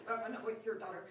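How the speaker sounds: giggling self-correction; laughing mock backpedal. Real, not acted. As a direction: catch yourself while still laughing.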